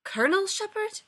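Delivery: high, alarmed question. A narrator's reading. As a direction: ask in sudden alarm. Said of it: "Roddick looked alarmed".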